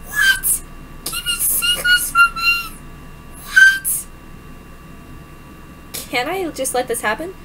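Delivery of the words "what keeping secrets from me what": very high squeaky outrage; shrill shocked voice. Acted. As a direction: squeak out the disbelief.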